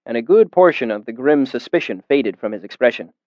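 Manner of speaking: ordinary delivery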